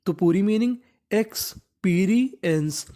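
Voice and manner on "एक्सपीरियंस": एक्सपीरियंस sounded out slowly, eks-pee-ri-yens; teacher spelling a word out slowly; slow syllable-by-syllable demo. Shown as a demonstration. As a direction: stretch एक्सपीरियंस out for the learner. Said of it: "एक्सपीरियंस का मतलब होता है अनुभव".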